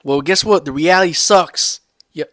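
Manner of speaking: normal talking voice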